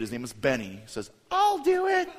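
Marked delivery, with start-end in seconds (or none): 1.3-2.1 put-on high-pitched small boy voice; little kid volunteering. Little kid raises his hand, high volunteering voice. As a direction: imitate a little kid piping up. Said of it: "But one little kid".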